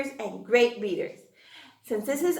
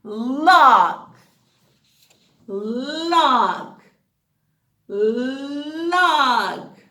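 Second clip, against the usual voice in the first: louder punched word; loud model word; 5 dB louder, much slower than usual. Shown as a demonstration. Teacher modelling a word, loud and clear. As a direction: call the word out. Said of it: "you repeat with me".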